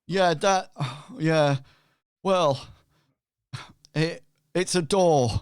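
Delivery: loud, halting reply in character; hesitant explanation, pushed out loudly; non-player character. Acted. Game master replies as Benito.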